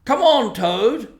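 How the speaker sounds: high, loud call into the dark; warning call. Acted. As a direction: call ahead sharply, urging him on. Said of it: "call out warningly".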